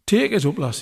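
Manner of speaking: lightly voiced injured fiddler groaning; groaned. A narrator's reading. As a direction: groan it out, hurt and pleading. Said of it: "he groaned".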